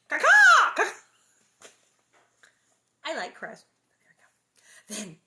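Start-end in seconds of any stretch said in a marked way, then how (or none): 0.1-1.7 loud, high, harsh crow caws; crow caw imitation. Reader performs the crows' cawing for listeners, loud and high. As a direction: screech it out like a crow. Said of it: "Should I? I should".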